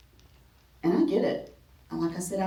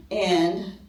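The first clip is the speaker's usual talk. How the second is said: usual speaking voice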